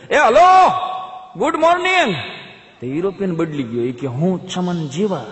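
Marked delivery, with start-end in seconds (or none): 0.1-2.2 bellowed, much higher and louder hello, good morning; shouted greeting. Retold or imitated. Chaman yelling good morning at the foreigner across the street. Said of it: "ચમને રાડ પાડી". 4.1-5.3 slow mock reply. Foreigner mimics villager slowly.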